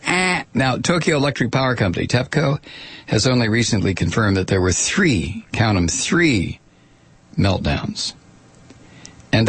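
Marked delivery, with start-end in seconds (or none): none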